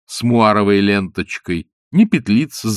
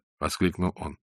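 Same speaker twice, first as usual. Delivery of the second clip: ordinary delivery, nothing marked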